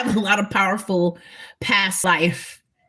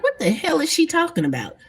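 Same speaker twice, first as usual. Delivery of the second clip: put-on higher, baffled young girl scoffing; mock her as a puzzled kid; young self confused; 8 semitones higher than usual. Girl baffled by her granny's saying, higher and scoffing. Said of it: "I used to be like".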